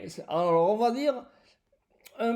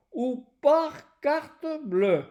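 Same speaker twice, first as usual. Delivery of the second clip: "par carte bleue" drawn out slowly, stressed on par; slow down and lean on "par carte bleue"; slow emphasis; 5 semitones higher, slower than usual. Shown as a demonstration. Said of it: "regardez bien". (énfasis lento en "par carte bleue")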